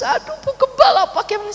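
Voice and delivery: half-laughing imitation of a crying plea; mock crying plea. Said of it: "sambil nangis". (menirukan jemaat menangis)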